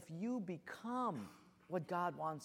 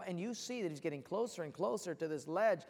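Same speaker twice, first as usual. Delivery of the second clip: ordinary delivery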